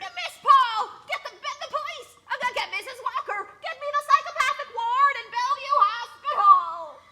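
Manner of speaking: high, hysterical calls for help; frantic calling. Acted. Calling staff after the attack.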